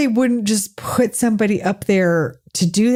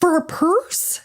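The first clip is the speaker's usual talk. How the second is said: high-pitched disbelieving question; incredulous; 8 semitones higher, faster than usual. Real, not acted. Co-host questioning a costly purse, incredulous. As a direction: balk at the price, pitch climbing.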